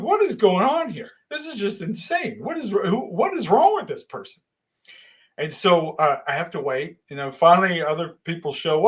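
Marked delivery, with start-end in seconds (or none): 0.0-4.4 high-pitched, incredulous outburst; exasperated disbelief. Retold or imitated. New worker baffled by the foreman, high exasperated disbelief. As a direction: act out utter bafflement, pitch way up.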